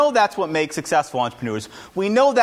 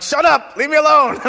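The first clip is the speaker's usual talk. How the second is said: high, loud shout at the door; retold frantic outburst; frantic yell; 11 semitones higher, 6 dB louder than usual. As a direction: yell them away from the door, frazzled. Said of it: "I'm like".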